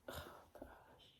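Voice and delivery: an unvoiced, hushed aside; embarrassed whisper to herself; whispered oh gosh. Real, not acted. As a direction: breathe it out barely audible. Reader reacts under breath.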